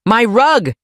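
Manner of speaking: grandma shrieking as her rug is ruined. Acted. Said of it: "she shrieked".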